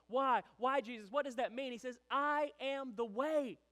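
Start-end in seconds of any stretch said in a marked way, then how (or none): 0.1-1.7 raised, louder pleading questions; urgent questioning. Acted. Disciples demanding answers from Jesus, urgent and loud. 2.1-3.6 slow, loud, high declaration; emphatic proclamation. Acted. Jesus answering his disciples, a slow loud proclamation.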